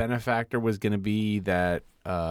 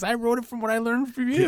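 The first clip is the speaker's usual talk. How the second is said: much higher, put-on admiring voice; mock reverent reply; 14 semitones higher than usual. Recapping Loki, playing Victor answering his idol in a high mock-reverent voice. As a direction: gush it back with hammed-up awe.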